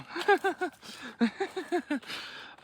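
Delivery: high giggling laugh. Real, not acted. Laughing about being disrespectful kids, high laugh.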